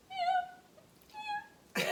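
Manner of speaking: very high, squeaky little meow; tiny high-pitched voice. Retold or imitated. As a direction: imitate a big man's tiny kitten voice. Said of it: "tiny little high-pitched voice".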